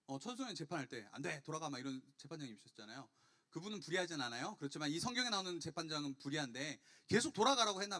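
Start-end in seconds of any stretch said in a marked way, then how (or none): none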